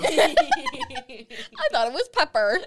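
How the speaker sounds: high, slow, laughing through the words. Real, not acted. To friends, laughing at her own mistake. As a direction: giggle it out, stretched and high.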